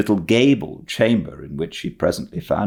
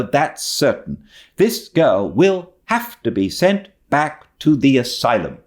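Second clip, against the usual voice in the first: higher, indignant and firm; wrathful spinster; 10 semitones higher, 3 dB louder than usual. Acted. Marilla insisting to Matthew the girl goes back, indignant. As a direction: lay down the law, still annoyed.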